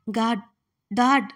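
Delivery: calling out loud for his father, urgent; loud frantic question. A narrator's reading. Injured son shouting to his dad for his missing wife, loud and frantic. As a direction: yell it up the house, desperate to find her. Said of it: "అని గట్టిగా అడుగుతుంటే".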